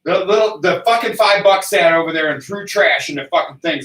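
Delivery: ordinary delivery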